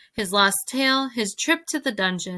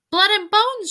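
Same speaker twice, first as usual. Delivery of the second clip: high, loud rat cry; shout; 12 semitones higher, 6 dB louder than usual. A narrator's reading. Another rat chimes in from the crowd, shouting. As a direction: top the last rat's call, pitched way up. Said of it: "shouted another".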